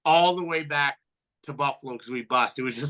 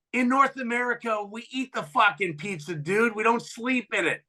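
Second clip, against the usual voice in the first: high-pitched taunt at a rookie; retold trash talk, pitched up; mocking chirp; 8 semitones higher than usual. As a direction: heckle him from the bench in a raised pitch.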